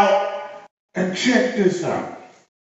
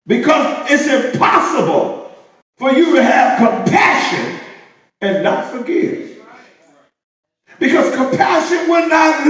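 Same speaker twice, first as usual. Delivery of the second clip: higher, louder, shouted sermon climax; fiery preaching; 9 semitones higher, 3 dB louder, slower than usual. Real, not acted. Preacher driving home compassion to his church, full-force preaching. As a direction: build to a whooping peak.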